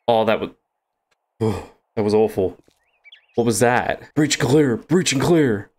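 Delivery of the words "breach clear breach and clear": clipped mock SWAT callout; tactical soldier callout. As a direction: call it like a soldier clearing a room. Playing a soldier clearing a room.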